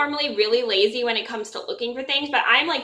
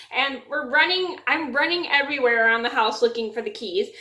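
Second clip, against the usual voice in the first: normal talking voice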